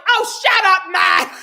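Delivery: loud, high dismissive yell; mocking shout. James shutting down crying Tom, loud scornful shout. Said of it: "James is like".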